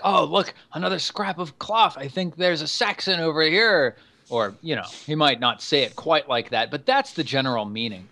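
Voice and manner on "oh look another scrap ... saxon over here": high, excited knight voice; Sir Quinn. Acted. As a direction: pipe up high with an eager discovery. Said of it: "Sir Quinn is like".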